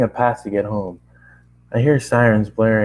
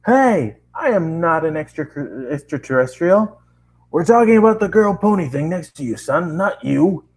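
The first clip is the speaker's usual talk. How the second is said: protesting narrator; 7 semitones higher than usual. Acted. Narrator argues with police.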